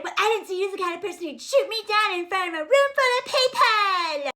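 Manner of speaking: high, put-on protesting voice; mock wounded whining. Boyfriend complaining he was shot down publicly, mock whiny. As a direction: whine it up high like a hurt baby. Mocks his wounded retort.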